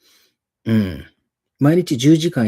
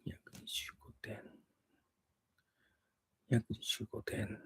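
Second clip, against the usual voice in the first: whispered number, thinking aloud; whisper to self; 3 semitones lower, 21 dB quieter than usual. Real, not acted. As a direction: breathe the number to yourself. Muttering while thinking.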